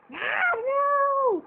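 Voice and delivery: high, panicked yelp; a sudden shocked squeal as it breaks; startled cry. Acted. Puppet breaks, technical difficulties.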